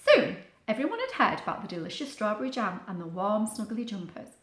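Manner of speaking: usual speaking voice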